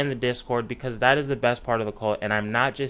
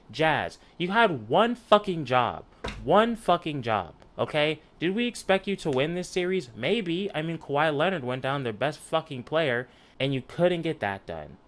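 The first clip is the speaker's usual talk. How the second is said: real angry rant, higher pitch; pissed off; 3 semitones higher than usual. Real, not acted. Pissed fan scolding the Jazz for blowing one job, angry and higher. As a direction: lay into them, really fed up. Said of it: "because I'm pissed".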